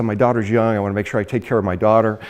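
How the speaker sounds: low, understated deadpan; dry humor. Retold or imitated. Recruiter jokes about his daughter, dry deadpan. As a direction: imitate a dry joker, pitched low. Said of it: "He's got the dry, hens-, dry sense of humor".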